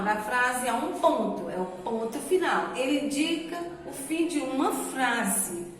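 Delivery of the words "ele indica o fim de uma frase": usual speaking voice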